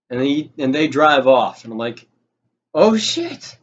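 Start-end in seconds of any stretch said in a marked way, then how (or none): 2.7-3.6 high, frantic panicked squeal. Retold or imitated. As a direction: spiral into sudden panic, voice jumping up. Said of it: "I'm instantly in panic mode".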